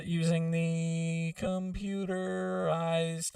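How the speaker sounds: slow auto-tuned drawn-out words; sung through pitch correction; auto-tune voice. Shown as a demonstration. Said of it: "using the auto-tune".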